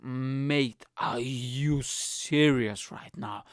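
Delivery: slow, drawn-out disbelief; slow mock outrage at the farter; slow incredulous. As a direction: stretch each word in stunned disbelief. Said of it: "I was like".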